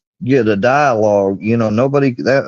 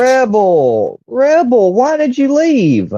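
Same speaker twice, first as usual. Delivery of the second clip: much higher, slow, calling after him; 13 semitones higher, 3 dB louder, much slower than usual. Real, not acted. Host calling after the guest who left the panel, pleading. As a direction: call across the room for someone walking away.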